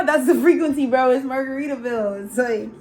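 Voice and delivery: normal talking voice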